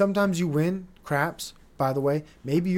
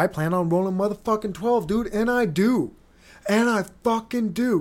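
louder and higher, building with glee; excited; 6 semitones higher, 5 dB louder than usual. Real, not acted. Host reliving rolling a twelve at craps, getting excited and loud. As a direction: pump it up like the dice just hit. Retelling his big craps roll.

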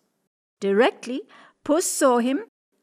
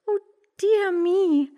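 much higher, slow mock-amazed cat; 10 semitones higher, 3 dB louder, slower than usual. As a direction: feign wonder like a sly cat. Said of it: "said Puss".

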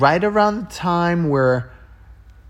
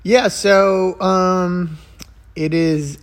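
ordinary talk, nothing marked

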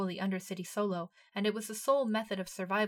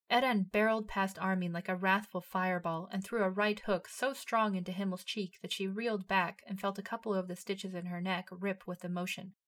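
ordinary delivery

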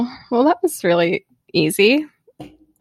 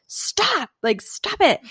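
frustrated stop; 10 semitones higher, 4 dB quieter, much slower than usual. Retold or imitated. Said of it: "I was just like".

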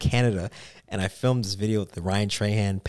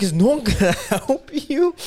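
high, loud retold disbelief broken by a laugh; incredulous, laughing; 13 semitones higher, 5 dB louder, slower than usual. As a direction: crack up while asking, pitch way up.

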